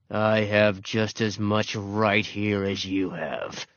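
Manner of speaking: slow, growled comeback; growling dog. A narrator's reading. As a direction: growl it back slowly, standing your ground. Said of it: "Ranger's growl answered".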